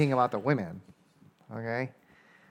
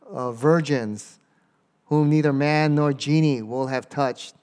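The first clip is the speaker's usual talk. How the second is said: slow, measured recitation; scripture reading; 2 semitones higher, 4 dB louder, slower than usual. Acted. Preacher reads the Quran verse to the church, slow and measured. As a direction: intone the verse slowly to the church.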